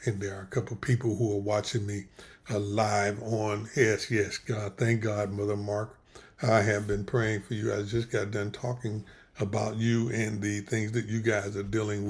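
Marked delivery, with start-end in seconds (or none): none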